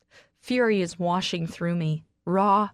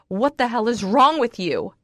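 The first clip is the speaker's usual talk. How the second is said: louder, angry yell; screaming; 4 semitones higher, 6 dB louder than usual. Acted. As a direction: scream it at him across the table. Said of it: "I start screaming".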